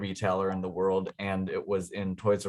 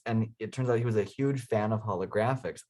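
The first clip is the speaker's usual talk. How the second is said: ordinary delivery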